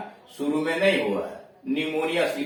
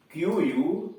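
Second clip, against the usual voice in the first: ordinary delivery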